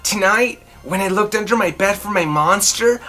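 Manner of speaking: ordinary delivery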